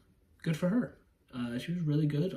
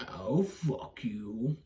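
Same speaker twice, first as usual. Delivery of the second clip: very slow, drawn-out mock vampire count; Count Orlok; 3 semitones lower, much slower than usual. Reviewer playing the count answering the frustrated wife, slow put-on voice. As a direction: stretch every word like an undead count.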